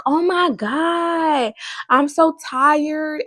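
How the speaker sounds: slow, high, drawn-out whine; mock tired complaint. Pastor imitating people, slow high whining complaint. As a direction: whine it out as if exhausted. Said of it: "they be like".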